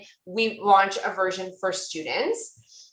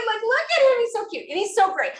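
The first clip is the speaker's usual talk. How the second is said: much higher giddy gushing; gushing squeal; 13 semitones higher than usual. Real, not acted. Presenter showing Nick's photo, gushing in a high voice. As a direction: gush like a starstruck fan. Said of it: "I sound terrible".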